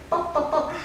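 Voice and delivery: high, light little pops; cartoon popping sound effect; popping taps. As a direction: make small tapping pop noises, high and quick. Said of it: "she went".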